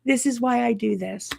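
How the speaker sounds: high-pitched cheer of triumph; triumphant joy. Retold or imitated. Teacher cheering her student's success, elated high exclamation. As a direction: celebrate like a proud teacher. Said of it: "I'm like".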